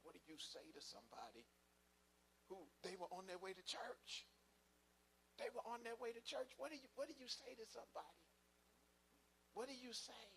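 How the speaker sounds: climbing anguished questions to God; a young minister begging God for words, voice climbing; desperate plea to God. Retold or imitated. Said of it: "I was asking myself".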